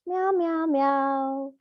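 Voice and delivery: high playful cat meows; meowing like a cat. Shown as a demonstration. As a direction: mew like a kitten. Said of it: "you can add on your cat pose".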